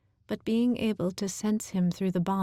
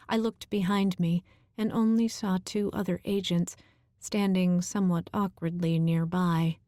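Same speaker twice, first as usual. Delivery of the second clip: plain reading voice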